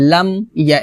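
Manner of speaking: "লাম ইয়া" with m shut and a break before ya; closed m, separated. Shown as a demonstration. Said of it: "এভাবে".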